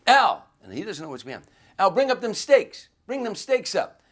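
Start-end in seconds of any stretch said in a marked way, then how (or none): 0.1-0.4 loud, high-pitched holler. Retold or imitated. Waiter calling to the other guy in the kitchen, hollered. 1.8-4.0 high, full-voiced kitchen yell; hollering. Waiter yelling for steaks across the kitchen, hollered high and loud.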